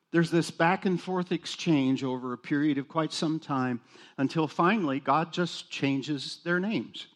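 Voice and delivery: usual speaking voice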